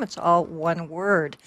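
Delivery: ordinary talk, nothing marked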